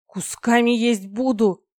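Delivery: loud, high, drawn-out menace; furious threat. A narrator's reading. Dragoness thrashing in the dragon's grip, loud angry threat. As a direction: stretch the threat out loudly. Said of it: "посыпались угрозы".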